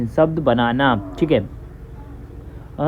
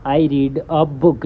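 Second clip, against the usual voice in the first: slow example sentence; much slower than usual. Shown as a demonstration. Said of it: "sentence".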